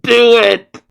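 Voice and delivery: loud high yell; shout. Retold or imitated. Vegeta on the ground urges Granola loudly. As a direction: bark the order.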